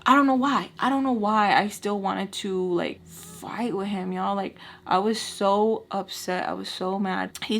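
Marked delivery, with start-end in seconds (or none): none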